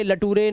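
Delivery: slow "लटूरे", la-TOO-ray; wrong spelling pronunciation. Shown as a demonstration. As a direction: misread lecture as "लटूरे" with a final "ay". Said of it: "ऐसा नहीं पढ़ना है".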